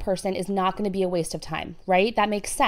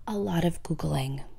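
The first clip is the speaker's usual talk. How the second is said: whispering; 3 semitones lower, 5 dB quieter than usual. Real, not acted. Whispered joke aside.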